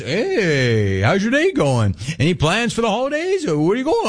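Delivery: high singsong small talk; overly bright customer-service voice; chirpy teller voice. Retold or imitated. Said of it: "you're there to ask".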